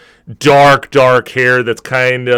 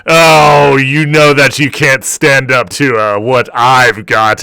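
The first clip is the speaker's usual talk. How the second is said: orc bartender boasting; 3 semitones higher, 6 dB louder than usual. Acted. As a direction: show off to the barmaid.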